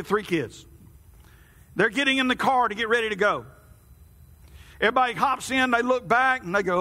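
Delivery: normal talking voice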